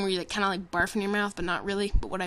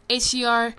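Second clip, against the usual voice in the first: ordinary talk, nothing marked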